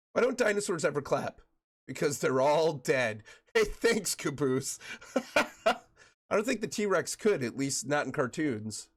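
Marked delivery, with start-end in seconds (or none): none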